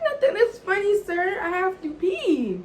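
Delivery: high, loud mock-indignant retort; exasperated protest. Retelling her protest when he laughed.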